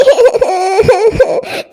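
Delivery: broken crying sob; tearful sob. Acted. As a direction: choke on a sob. Girl sobs.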